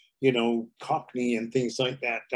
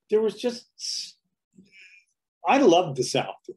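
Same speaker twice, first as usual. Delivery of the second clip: normal talking voice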